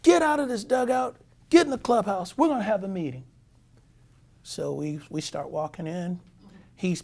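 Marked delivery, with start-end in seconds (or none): 0.0-3.2 loud, high bark of a furious manager; angry manager yelling. Retold or imitated. Manager yelling at his players to get in the clubhouse. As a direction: yell it like a fed-up manager. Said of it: "He says".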